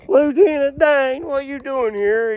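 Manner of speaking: high, slow call to Lieutenant Dane, lightly voiced; a hint of Forrest shouting from the water; imitating Forrest calling out.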